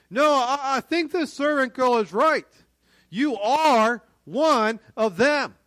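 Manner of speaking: high, loud, insistent accusation; adamant. Acted. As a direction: stand firm and press the accusation loudly.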